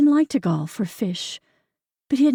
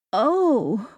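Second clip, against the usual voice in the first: dawning realization; 4 semitones higher than usual. A narrator's reading. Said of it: "like a light turning on".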